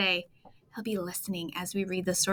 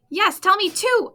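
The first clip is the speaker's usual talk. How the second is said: much higher and louder; shout; 11 semitones higher, 8 dB louder than usual. Acted. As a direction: yell it eagerly. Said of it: "I shout".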